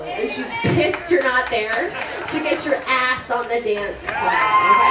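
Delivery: loud, urgent call to the crowd; rallying shout. Real, not acted. Bride shouting at the guests to hit the dance floor. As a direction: get everyone up and dancing.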